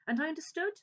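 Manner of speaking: high, tense, near-breaking line; strained rising. Acted. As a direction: let the strain rise. Hyde fighting hysteria.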